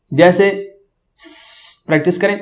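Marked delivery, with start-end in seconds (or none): 1.2-1.9 bare voiceless س, no vowel; whistling hiss. Shown as a demonstration. Teacher demonstrates before the letter, soft whistling س. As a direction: let س whistle softly through the teeth. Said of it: "سیٹی کی آواز نکالیں گے".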